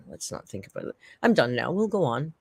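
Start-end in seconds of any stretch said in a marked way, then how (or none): 0.1-1.0 low whispered aside. Real, not acted. Streamer whispering to drop the topic.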